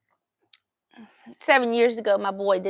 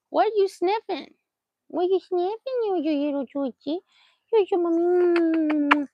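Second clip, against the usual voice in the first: doting pet talk; 8 semitones higher than usual. Real, not acted. Owner asking her sniffing dog, cooing baby talk. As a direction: coo playfully, drawing out the last words.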